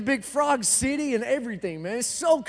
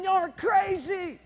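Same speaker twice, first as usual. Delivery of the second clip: high mock scream; 6 semitones higher, 4 dB quieter, slower than usual. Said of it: "they're all sitting there screaming".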